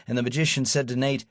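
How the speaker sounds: usual speaking voice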